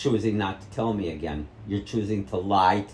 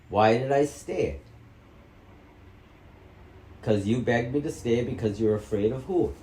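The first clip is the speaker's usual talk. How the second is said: ordinary delivery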